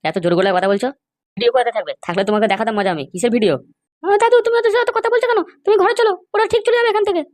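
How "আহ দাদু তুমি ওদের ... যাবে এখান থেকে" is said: put-on much higher, girlish and pleading; a young girl coaxing grandpa indoors; granddaughter's voice. Granddaughter pulls grandfather away. (উঁচু গলায় মেয়েলি অনুনয়)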